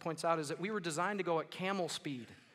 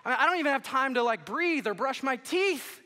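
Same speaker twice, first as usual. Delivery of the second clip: high, loud, overwhelmed tirade; hammed-up stressed-out parent venting; exasperated rant; 7 semitones higher, 10 dB louder than usual. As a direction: build to a shouted, stretched-out finish. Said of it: "You're probably thinking".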